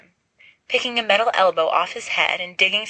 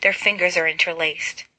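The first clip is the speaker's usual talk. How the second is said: ordinary delivery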